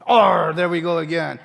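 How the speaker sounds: loud, hammed-up pirate grumble; a stage pirate, staying in character. Shown as a demonstration. The teacher acts a pirate staying in character, hammed up. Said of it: "you can be the pirate".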